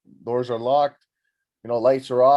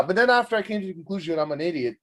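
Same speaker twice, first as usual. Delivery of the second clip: usual speaking voice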